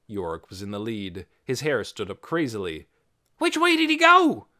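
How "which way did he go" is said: loud, high-pitched demand; urgent yell. A narrator's reading. Yorick yelling at Minnie about the runaway, high urgent yell. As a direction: yell it across the square. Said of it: "he yelled at Minnie".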